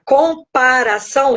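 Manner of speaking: "comparação" pulled apart as com-para-ção; split into syllables. Shown as a demonstration. Preacher dividing the word to explain it, "comparação" in spaced syllables. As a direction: separate each part of "comparação" deliberately. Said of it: "se eu separar".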